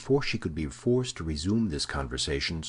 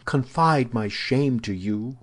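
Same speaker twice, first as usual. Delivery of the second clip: higher, louder, slow protest; shamed outcry; 6 semitones higher, 5 dB louder, slower than usual. A narrator's reading. Woman asked to confess to her guard, crying out in shame. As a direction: cry out in modest dismay. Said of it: "cried Milady".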